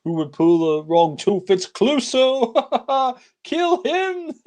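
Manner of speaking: crazed villain, climbing to a high cackle; hammed-up hysterical villain laughing through his rant; Dreyfus. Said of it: "you see that Dreyfus says".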